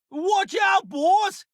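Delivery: shouted high warning to the boss. Acted. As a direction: yell it out as danger hits.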